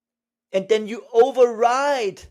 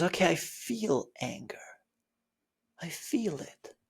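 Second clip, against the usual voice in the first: soft breathy acceptance; whispered acknowledgment; 7 dB quieter than usual. Pretend. Student accepting the anger, soft whisper.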